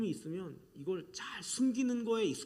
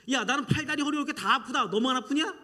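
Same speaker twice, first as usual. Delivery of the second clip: put-on higher and louder, a mother one-upping her child's pain; mock complaining shout; 7 semitones higher, 9 dB louder than usual. Mother to whining child, loud mock complaint. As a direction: shout back that you hurt more.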